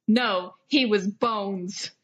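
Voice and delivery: drawn-out, slow deadpan delivery; slow spooky deadpan. Acted. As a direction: let each word hang, slowly. Deadpan bones line, listener laughs.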